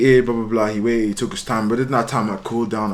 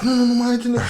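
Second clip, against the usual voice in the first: higher, hurried mock protest; panicked denial; 12 semitones higher than usual. Cornered boy denying it to his attacker, mock panicked. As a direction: imitate someone begging off.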